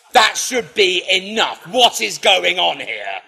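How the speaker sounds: slow, loud, mock-indignant protest; affected righteous anger. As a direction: ham up the outrage in court, drawn out and loud. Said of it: "claimed my righteous anger ... in rather an affected and a gregarious way".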